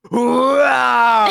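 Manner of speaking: high, drawn-out hoisting yell; play-wrestling lift cry. Retold or imitated. Friend retells hoisting the dog, mock battle cry. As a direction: strain it out like a wrestler lifting.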